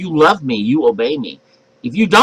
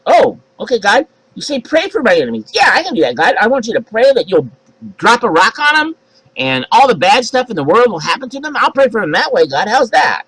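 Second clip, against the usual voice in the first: sly believer; 7 semitones higher than usual. Acted. Believer mock-agreeing with God.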